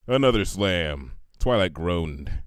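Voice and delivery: ordinary delivery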